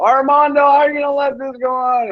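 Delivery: very high, fast whine to the moderator; whiny troll voice. Retold or imitated. Troll whining to moderator Armando, high and fast. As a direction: whine like a sulking child. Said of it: "he's whining to Armando".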